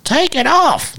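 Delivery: high mock catcall from the pit; a high hammed-up catcall; mock thirsty fan yell. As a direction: imitate drunk women yelling at the stage. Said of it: "thirsty women were telling Paddy to take it off".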